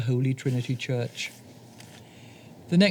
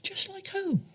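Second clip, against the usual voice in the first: whispered high, breathless mouse; 10 dB quieter than usual. Acted. Mouse whispering to moose, high and soft. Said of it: "said Mouse".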